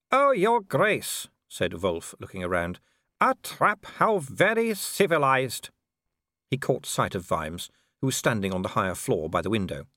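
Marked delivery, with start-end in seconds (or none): 0.1-1.3 high, loud, drawn-out greeting; werewolf villain. Acted. Werewolf greets Vimes on the gallery, high mock courtesy. 3.2-5.7 high-pitched, drawn-out mock admiration; werewolf villain. Werewolf remarks on Vimes's trap, high mock admiration.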